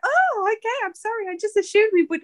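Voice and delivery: high, put-on placating reply; high-pitched imitation of backing down; mock apology. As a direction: act out an over-polite sorry. Retold reply to the urgent demand.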